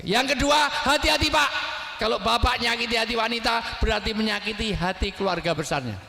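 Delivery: high, forceful sermon warning; impassioned preaching. Real, not acted. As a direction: raise the voice to warn the congregation, then ease down. (khotbah berapi-api, suara tinggi)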